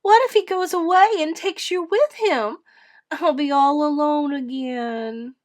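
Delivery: put-on high, loud, distressed child; acted plaintive high wail. A narrator's reading. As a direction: wail in dread of being left. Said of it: "Edith wailed".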